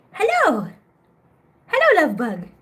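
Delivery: a high squeaky hello; high cooing hello. Real, not acted. Owner greeting a sleepy cat with a high coo.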